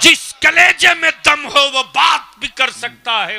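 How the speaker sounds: high, sustained full-force shout; defiant thundering. Real, not acted. Preacher crediting his spiritual master and daring opponents, thundering. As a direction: build the climax at the top of your voice.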